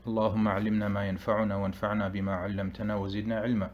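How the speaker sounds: rapid, even Arabic recitation; opening supplication. Real, not acted. Teacher opening a lesson with prayer, rapid formal recitation. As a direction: recite the opening praise quickly and evenly.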